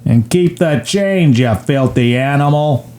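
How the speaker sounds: hammed-up movie gangster; Johnny. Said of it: "And then Johnny says".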